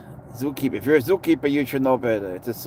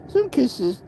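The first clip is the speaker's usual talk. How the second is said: much higher, cutesy squeak; 13 semitones higher, 4 dB louder than usual. Real, not acted. Owner coos at cat's kisses, high squeak.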